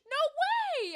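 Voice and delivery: high, loud excited outburst; real burst of happy surprise; thrilled disbelief. Real, not acted. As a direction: squeal it in delighted amazement. Reacting to friend's good news.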